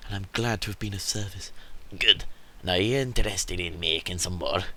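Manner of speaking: lightly voiced Scottish-ish merchant; a hint of a Scottish merchant; put-on merchant accent. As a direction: read the merchant in a made-up accent. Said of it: "what the hell is going on with his accent".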